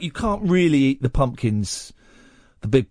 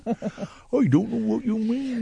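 mocking echo; 9 semitones higher, much slower than usual. Mocking cohost's line again.

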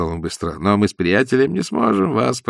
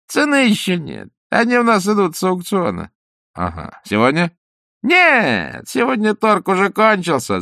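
much higher, indifferent clerk voice; 8 semitones higher than usual. Acted. The auction clerk answers Ostap indifferently in a high voice. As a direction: play the bored clerk high.

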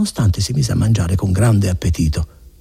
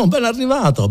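laughing greeting. Acted.

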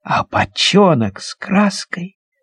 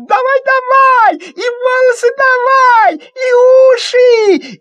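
much higher, slow shrieking urging; urgent squeal; 4 dB louder, slower than usual. Acted. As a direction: shout to be painted all over. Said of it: "Алёнка торопит". (визгливо торопит)

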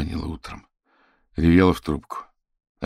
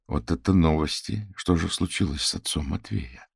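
ordinary delivery

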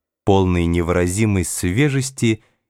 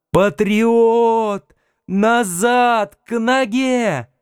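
put-on high, drawn-out child's shout to a dog; boy yelling; 12 semitones higher, much slower than usual. A narrator's reading. Boy in a cap ordering his dog back, high-pitched yelling. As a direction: imitate a boy's very high-pitched yell. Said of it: "орал".

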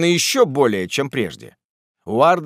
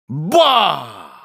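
loud, drawn-out "bah" of surprise; high, loud exclamation; astonished cry; 4 semitones higher, 5 dB louder than usual. Acted. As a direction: cry out on spotting someone unexpected. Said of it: "закричал Уардель".

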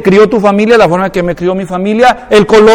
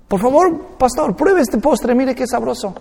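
higher, eager coaxing; imitated coaxing; 4 semitones higher, 4 dB quieter than usual. Dominican hosts urging the pastor to try dessert, coaxing. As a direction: coax like an insistent host, lightly imitated.

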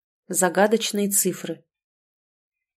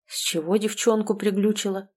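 ordinary delivery

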